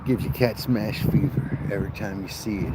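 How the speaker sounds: normal talking voice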